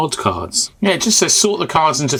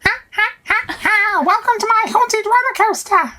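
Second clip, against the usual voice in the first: very high, thin tinny villain voice. Retold or imitated. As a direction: screech it out like a tinny speaker. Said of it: "yours was tinnier".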